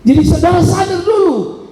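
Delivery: high, driving sermon delivery; forceful preaching. Acted. Preacher urging the congregation to realise why he seeks God, forceful.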